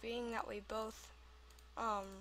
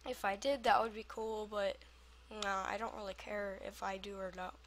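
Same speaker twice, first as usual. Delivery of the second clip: ordinary talk, nothing marked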